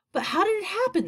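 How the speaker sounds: high-pitched bewildered outcry; distressed cry. A narrator's reading. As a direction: cry out the question in dismay. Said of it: "they cried".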